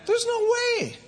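very high squealed protest; incredulous, sky-high denial; emphatic high exclamation. Real, not acted. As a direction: leap right up into a squeal. Emphatic denial of coping alone.

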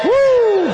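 high whooping shout; joyful woo shout. Real, not acted. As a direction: let out a loud woo. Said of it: "Shout with me a minute".

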